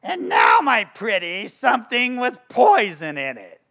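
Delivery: high shrieking witch, loud and menacing; shrill evil witch conjuring; wicked witch voice. Retold or imitated. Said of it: "And she goes".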